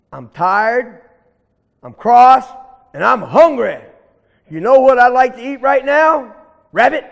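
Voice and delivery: loud, mounting storybook threat; angry bully. Acted. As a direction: build up crossly to a hungry threat. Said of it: "I'm angry".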